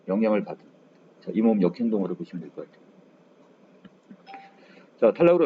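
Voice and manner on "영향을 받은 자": ordinary talk, nothing marked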